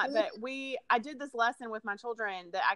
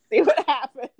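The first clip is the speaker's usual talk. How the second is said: laughing; 7 semitones higher, 10 dB louder than usual. Real, not acted. Laughing at her own joke.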